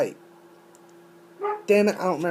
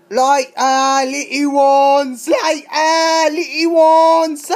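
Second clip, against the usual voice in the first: high, loud, stretched-out singsong call, climbing on the repeat; silly sign-off voice; 5 semitones higher, 5 dB louder, slower than usual. Shown as a demonstration. Bidding viewers goodbye, loud high silly voice. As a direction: call it out slowly, higher the second time.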